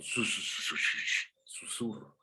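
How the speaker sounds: whispered su-su-susurro, fading to breath; demo whisper. Shown as a demonstration. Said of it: "Está imitando".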